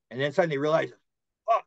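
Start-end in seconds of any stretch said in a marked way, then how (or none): 1.5-1.6 higher-pitched upset outburst, retold. Man realizing he volunteered for this, upset. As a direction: imitate someone suddenly appalled at himself. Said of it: "He's all upset".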